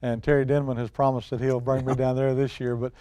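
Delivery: usual speaking voice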